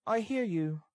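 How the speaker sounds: put-on high-pitched girl's voice; high voice for the put-upon girl; girl answering back. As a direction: answer in a high, light character voice. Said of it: "forcing a laugh".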